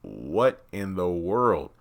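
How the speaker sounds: very slow, drawn-out disbelief; stunned disbelief. Retold or imitated. Vlogger mirroring Ruth's reaction, drawn-out disbelief. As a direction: stretch every word, dumbfounded. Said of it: "It's like".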